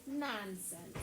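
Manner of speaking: ordinary talk, nothing marked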